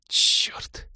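breathless hissed curse; whispered curse. Acted. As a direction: curse under your breath. Sudden realisation, curse.

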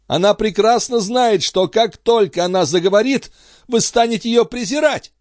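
high, pained agitation; agitated. A narrator's reading. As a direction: work yourself up through the pain. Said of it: "приходя всё в большее возбуждение от собственной боли". (взволнованно, высоким голосом)

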